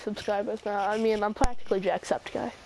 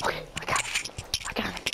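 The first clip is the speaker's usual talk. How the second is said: whispered; 2 semitones lower, 6 dB quieter, faster than usual. Real, not acted. Whispered after kill.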